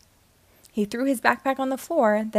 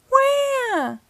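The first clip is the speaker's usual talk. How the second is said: high, loud drawn-out wail; high-pitched cartoon wail; 5 dB louder than usual. A narrator's reading. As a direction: wail out in dismay. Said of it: "Rex wailed from the hallway".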